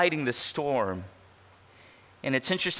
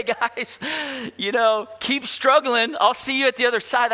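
flippant passerby; 7 semitones higher, 4 dB louder than usual. Pretend. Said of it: "what that means is not".

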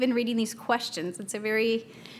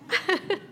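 laugh; 9 semitones higher than usual. Real, not acted. Mother amused by her toddler.

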